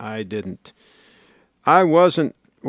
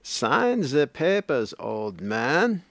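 [name] bit; 3 semitones higher, much slower than usual. Retold or imitated. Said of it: "that bit by [name]".

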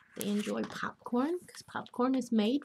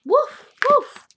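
high, loud woofing; two sharp high barks, acted out; dog barks; 13 semitones higher, 11 dB louder than usual. Grandmother reading Biscuit book to children, dog barks.

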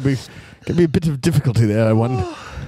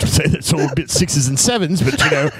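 put-on English accent; 2 semitones lower than usual. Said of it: "let me hear you do an Englishman".

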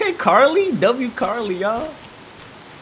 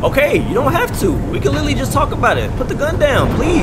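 higher-pitched, urgent plea to a game character; pleading; 7 semitones higher than usual. Pretend. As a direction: talk the gunwoman down, higher than usual.

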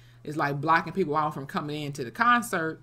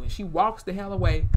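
ordinary delivery

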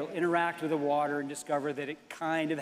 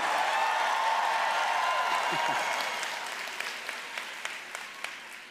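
evil cackle. Acted. Cackle after Hatbox Ghost announcement.

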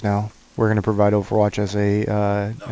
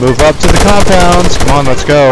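shouting orders; 6 semitones higher, 11 dB louder than usual. Real, not acted. Firefight, leader orders squad forward.

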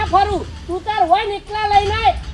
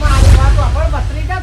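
lower, louder plea; firm call to listen; 6 semitones lower, 7 dB louder than usual. Acted. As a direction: stop him firmly. Uncle restraining the angry nephew.

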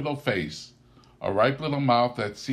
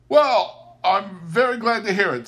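high, loud cheerful reply; cheerful nephew; 11 semitones higher, 6 dB louder than usual. A narrator's reading. Nephew teasing the ladies after dinner. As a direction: answer brightly, teasing. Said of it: "said Scrooge's nephew".

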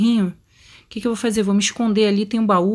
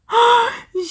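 shocked gasp; 11 semitones higher than usual. Real, not acted. Gamer recovering from a jump scare, shocked gasp. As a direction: suck in air after a real scare.

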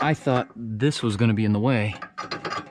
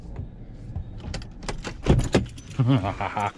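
breathy laugh; 4 semitones lower, 4 dB quieter than usual. Real, not acted. Laughs after woo.

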